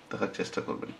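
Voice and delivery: ordinary talk, nothing marked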